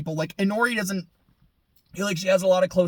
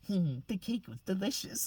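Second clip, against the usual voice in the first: much softer, hushed mock line; mock deadpan; 12 dB quieter than usual. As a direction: murmur it like a smug cartoon.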